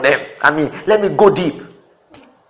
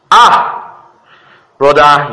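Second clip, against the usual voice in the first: mock commendation; 7 dB louder, much slower than usual. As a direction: burst out loudly as the consoling brother. Acting the brother's backhanded praise.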